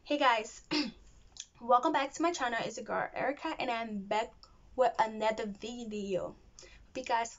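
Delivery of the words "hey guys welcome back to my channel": ordinary talk, nothing marked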